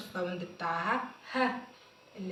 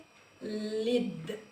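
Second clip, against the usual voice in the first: خالد drawn out slowly, falling; very slow sounding out; much slower than usual. Shown as a demonstration. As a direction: stretch خالد syllable by syllable. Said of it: "sounding out the name".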